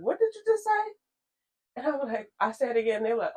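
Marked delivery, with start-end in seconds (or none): 0.0-0.9 high, startled "what did you just say"; high, startled retold reaction; shocked question. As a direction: jump up in pitch, taken aback. Retelling people's shocked reaction.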